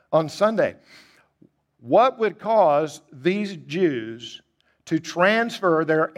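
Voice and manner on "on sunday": Sunday repeated through a laugh. Shown as a demonstration. Preacher repeating to the congregation with an amused laugh. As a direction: chuckle it out in amazement.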